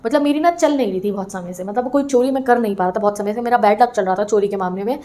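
normal talking voice

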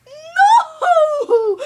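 laughing no. Acted. Laughing denial.

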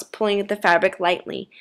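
plain reading voice

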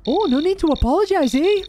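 very high, hammed-up Canadian accent; bad Canadian accent. Resident reassuring officer, high exaggerated Canadian accent. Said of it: "I can't do a Canadian accent".

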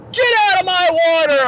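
high-pitched mock order; mock instructor yell. Instructor ordering a trainee out of the water, mock yell.

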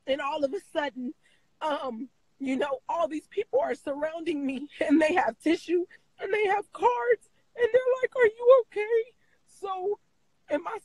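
high, tearful and breaking voice; crying while reliving the moment; real crying. Real, not acted. Retelling father's death, crying.

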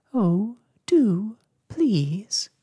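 high, loud pleading; begging. A narrator's reading. Ragged Blossom pleads with the silent patient, begging. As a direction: coax imploringly, each word drawn into a plea. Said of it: "begged Ragged Blossom".

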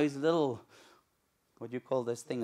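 normal talking voice

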